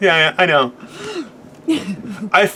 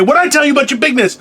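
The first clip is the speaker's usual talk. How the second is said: quick, higher-pitched telling-off; mock scolding; 7 semitones higher, much faster than usual. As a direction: imitate an exasperated scolding, rapidly. Acting out scolding a tall person.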